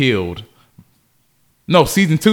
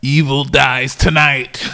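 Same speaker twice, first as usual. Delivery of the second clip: movie trailer voice; 3 semitones higher, 4 dB louder, slower than usual. Acted. Dramatic movie title announcement.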